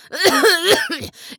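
rough hacking cough. A narrator's reading. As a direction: cough hard in character. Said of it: "Furlong hustete".

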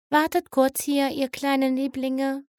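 cooing. Acted. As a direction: coo it like talking to babies. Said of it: "gurrte das gelbe Pegasus-Pony".

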